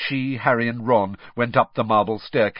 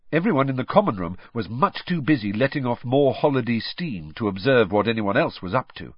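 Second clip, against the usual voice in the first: ordinary delivery, nothing marked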